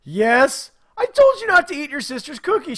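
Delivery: high, loud exasperated scold. Retold or imitated. Dad scolds daughter in checkout line, loud and exasperated. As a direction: let her have it, fed up. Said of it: "I look at her and said".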